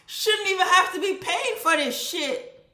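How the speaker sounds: yelled high and loud in mock fury; furious outburst at the power company; angry shout. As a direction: blow up at the bill, shouting. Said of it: "I be like".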